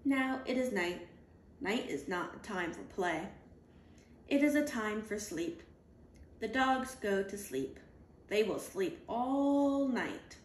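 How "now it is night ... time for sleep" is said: usual speaking voice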